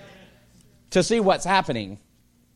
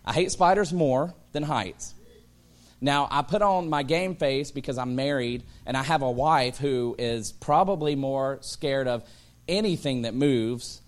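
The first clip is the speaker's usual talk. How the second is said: normal talking voice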